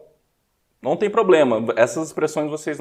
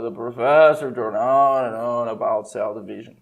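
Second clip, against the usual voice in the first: bored tone; 3 semitones lower than usual. Retold or imitated. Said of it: "pelo tom da voz do cara".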